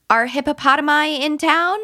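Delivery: high, bright silly code question; playful code question. Shown as a demonstration. Parent playing a code game with her son, playful and high. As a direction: ask it like a fun secret password. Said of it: "It could be".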